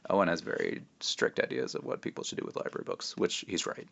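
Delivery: hushed, breathy-free whisper to the listener; conspiratorial whisper aside. Real, not acted.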